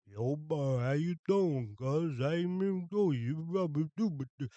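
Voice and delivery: put-on mumbled South Philly Italian accent, high and loud; exaggerated South Philly accent, big swooping pitch and garbled words; Rocky-style South Philly accent. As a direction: slur it like a caricature Philly boxer with rocks in the mouth. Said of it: "something like the nineteen seventy-six Rocky accent".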